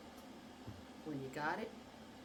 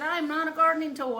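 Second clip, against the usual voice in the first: higher, louder mock scolding; indignant telling-off; 9 semitones higher, 10 dB louder than usual. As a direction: put him in his place, re-enacted. Acted telling-off.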